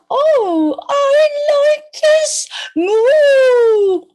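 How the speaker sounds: high, loud, drawn-out cow voice; cow character. Acted. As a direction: stretch it out into a big moo. Cow answers in the story.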